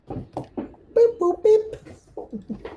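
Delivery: high mechanical beep-boop noises; robot beeps. Pretend. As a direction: chirp like a little drone. Players mimic the scanning pups.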